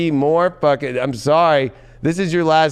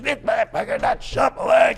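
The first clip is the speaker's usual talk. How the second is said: much higher mock-indignant yell; annoyed viewers; 9 semitones higher than usual. Reader imitates irritated Sagittarius viewers, high mock yell. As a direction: play the grumbling viewer, shouted. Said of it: "some Sages being like".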